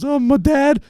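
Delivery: high, whiny imitation of her crying; hammed-up tearful voice; mock crying. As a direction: mimic a girl wailing through tears. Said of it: "she starts, like, crying".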